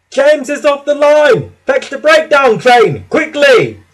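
high, loud alarm call; urgent shout. A narrator's reading. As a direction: shout the alarm across the yard. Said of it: "shouted one of the men".

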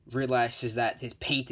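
ordinary delivery

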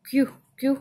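"क्यू" as short, separate kyu sounds; phonic Q sound. Shown as a demonstration. Teacher showing the sound of Q, "क्यू" sounded out. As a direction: sound out each "क्यू" briefly. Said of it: "क्यू का sound होता है".

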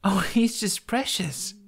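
put-on young woman delighted by a purring dragon, laughing. A narrator's reading. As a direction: melt over the tiny dragon, laughing. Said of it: "Kamikaze laughed with delight".

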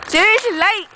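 ordinary talk

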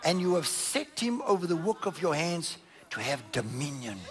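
ordinary delivery

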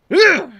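high, loud grunt of effort; frustrated grunt. Real, not acted. Player misses a move, frustrated grunt. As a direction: let out an exasperated ugh.

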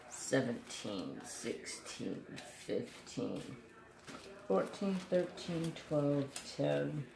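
soft murmured countdown; quiet counting. Real, not acted. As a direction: count down under your breath. Counting softly, fading.

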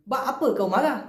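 "Ba apa kau marah" said with "Kenapa" cut to "Ba" and "marah" softened to "maga"; Malaysian shortcut. Shown as a demonstration. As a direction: clip "Kenapa" down to a quick "Ba" at the start. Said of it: "শর্টকাটে হবে". (শর্টকাটে "Ba apa kau marah", "Kenapa" ছোট করে)

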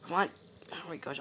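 ordinary talk, nothing marked

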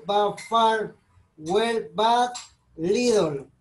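normal talking voice

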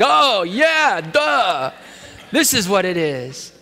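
high, loud burst of mock realization "oh yeah, duh"; sudden realization. Preacher acting out Peter finally getting it, with a high loud mock realization. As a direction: play the dawning aha, hammed up. Peter's aha moment acted out.

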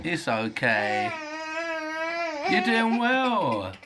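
slow high comforting coo. Real, not acted. Dad soothing his frustrated crying son, slow and high. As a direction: comfort him gently.

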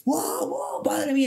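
high, awed "wow, madre mía"; amazed crowd. Retold or imitated. Fans in a bar marvelling at Vinicius, high awed cries. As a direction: imitate fans gasping in wonder at a dribble.

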